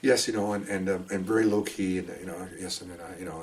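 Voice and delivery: soft, low-key mumbling delivery; very quiet. Retold or imitated. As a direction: barely speak up, nice and low-key. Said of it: "really nice, very quiet. She's like this".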